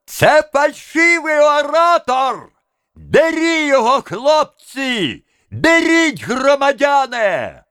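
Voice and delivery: very high, drawn-out accusing cries; piercing tenor alarm; thin tenor shout. A narrator's reading. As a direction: urge the crowd on in a thin, high yell. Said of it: "кричал в ответ первому второй тонкий тенор".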